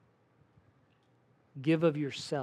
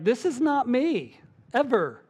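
mock refusal; 7 semitones higher, 4 dB louder than usual. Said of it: "some people who are like".

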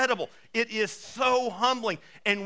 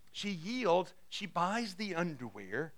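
ordinary talk

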